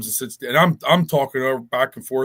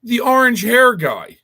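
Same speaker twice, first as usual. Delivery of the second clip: mock dismissive lady; 10 semitones higher, 8 dB louder, slower than usual. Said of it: "She goes".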